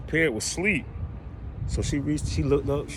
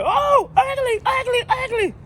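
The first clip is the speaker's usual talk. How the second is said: very high, loud flapping squawk; squawking parrot; 6 dB louder, slower than usual. Retold or imitated. Parrot flapping and squawking at the lady. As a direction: shriek it like a bird.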